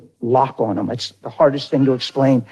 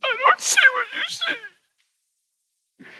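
very high, soft, slow whimper; sobbing son; 7 dB quieter, slower than usual. Retold or imitated. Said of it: "sobbed like a baby and said".

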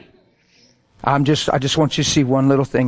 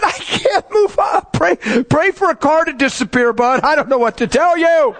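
high-pitched, strained mock outburst; exasperated protest; 10 semitones higher than usual. Driver to pushy passenger in traffic jam, exasperated. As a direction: act out a driver at wit's end.

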